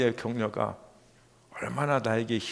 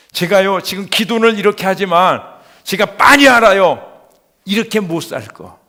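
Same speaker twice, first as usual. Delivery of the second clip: impassioned shouted confession; loud pleading protest; 7 semitones higher, 14 dB louder than usual. Retold or imitated. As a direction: cry out high and very loud in prayer. Retold desperate protest in prayer.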